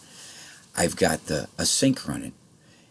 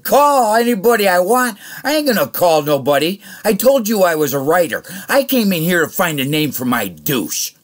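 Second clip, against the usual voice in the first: much higher and louder mocking comeback; loud, laughing comeback at the boss; retold loud retort; 13 semitones higher, 9 dB louder than usual. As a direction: fire the comeback back loud.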